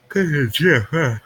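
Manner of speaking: talking through a yawn; words stretched by a yawn. Real, not acted. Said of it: "Sorry for my yawning".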